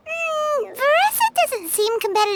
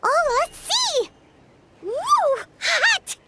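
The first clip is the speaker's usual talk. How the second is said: eager excitement; 7 semitones higher, much slower than usual. Acted. Examining glowing core.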